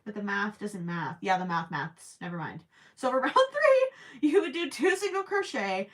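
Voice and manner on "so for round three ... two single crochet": very high, loud silly voice; playful squeaky restart. Pretend. Restarting round three instructions for a viewer, squeaky.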